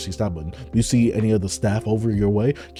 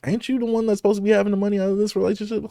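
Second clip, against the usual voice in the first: much higher, fast, disbelieving; can't believe what she's hearing; incredulous outrage; 11 semitones higher, faster than usual. Real, not acted. Reacting to the boyfriend taking her money, high incredulous outrage.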